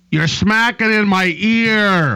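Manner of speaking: high, loud, slow annoyed complaint; complaining coworker. Retold or imitated. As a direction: drag out the coworker's complaint, exaggerated. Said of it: "tell me the other day that".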